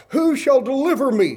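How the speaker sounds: high, loud plea for rescue; desperate cry. Acted. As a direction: cry out as Paul, begging for deliverance. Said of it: "And here's his cry".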